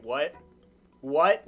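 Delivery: ordinary talk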